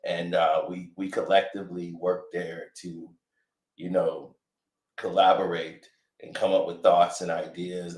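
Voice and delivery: smooth deflecting celebrity testimony. Retold or imitated. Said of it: "he gonna be like".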